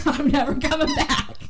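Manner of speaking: high-pitched, breaking up with laughter; laughing. Real, not acted. Storyteller cracking up over the yoga class, laughing. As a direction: say it through real giggles.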